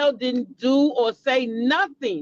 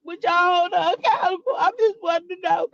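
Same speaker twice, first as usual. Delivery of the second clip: higher, put-on pleading voice; mock whiny plea; 6 semitones higher, faster than usual. Vlogger mimics a streamer's plea to chat in a higher whine. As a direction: whine it in a mocking falsetto. Mocking his plea again.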